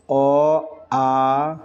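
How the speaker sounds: teacher calls out the vowels; vowel recitation. Shown as a demonstration. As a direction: sound out অ then আ for the class. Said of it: "সংস্কৃত স্বরবর্ণ কী".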